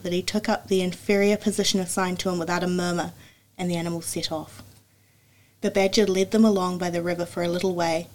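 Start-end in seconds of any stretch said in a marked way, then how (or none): none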